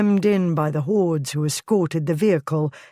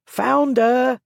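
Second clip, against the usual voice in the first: higher, loud mocking cry; crowd cry; 7 semitones higher, 4 dB louder than usual. A narrator's reading. Crowd jeering at the lord in his carriage, loud cry. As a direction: call it out to the mob with glee. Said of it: "took up the cry with variations".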